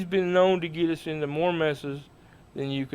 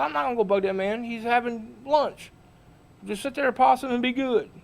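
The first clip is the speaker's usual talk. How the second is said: higher, mock-stern hush to a friend; mayor scolding; 8 semitones higher than usual. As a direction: tell Possum to behave, pitched up. Mayor quoting himself scolding Possum.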